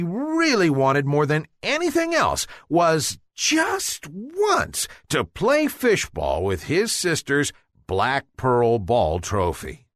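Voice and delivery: plain reading voice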